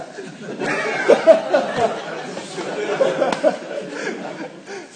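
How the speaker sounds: long high-pitched laugh; real laughing fit. Real, not acted. Preacher amused by his tongues story, real long laugh. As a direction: burst into helpless laughter.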